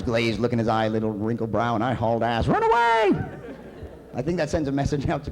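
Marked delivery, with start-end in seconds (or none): 2.5-3.1 very high, loud squealed cry; panicked yell. Retold or imitated. Fleeing the mugger, panicked high shriek. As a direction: shriek it as you flee.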